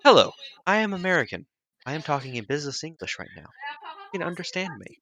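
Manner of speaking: lightly stiff, robotic business English; a touch of a flat robot reading lines. Shown as a demonstration. Game master to players, mild robotic business English. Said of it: "I do a really good business English".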